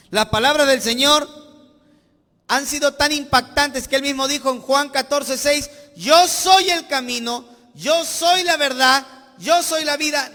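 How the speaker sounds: loud, fervent sermon build; full-force preaching. Acted. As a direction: preach it out to the whole church.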